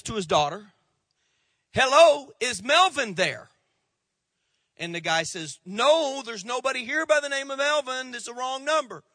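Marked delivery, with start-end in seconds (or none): 1.7-3.5 loud, high, cheery-style phone greeting; phone caller. Acted. Dad makes a prank call. 5.6-9.0 high-pitched, annoyed phone reply. Acted. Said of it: "Now that is annoyed".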